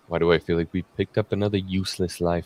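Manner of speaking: low, quiet Obi-Wan quote; film quote. Retold or imitated. Quoting an Obi-Wan film line.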